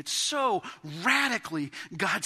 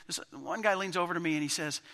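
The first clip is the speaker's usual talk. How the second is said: ordinary talk, nothing marked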